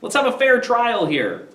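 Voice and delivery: high, eager pleading for peace; pleading patricians. Retold or imitated. Politicians soothing a riot, high pleading. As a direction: coax the crowd toward calm.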